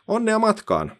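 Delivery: very high, bright encouragement; cheering. A narrator's reading. Apples cheer the squirrels and bees on their way. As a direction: cheer them on. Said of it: "puun omenat kannustivat".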